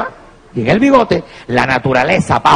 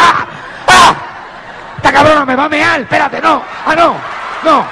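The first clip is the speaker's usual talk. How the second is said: startled panic; 10 semitones higher than usual. Retold or imitated. Waking on bus, woman's armpit.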